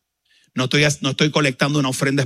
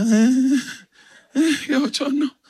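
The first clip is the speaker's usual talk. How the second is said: much higher squeaky embarrassed groan; mock embarrassment; 12 semitones higher, slower than usual. Pastor singled out in front of everyone, high embarrassed squeak.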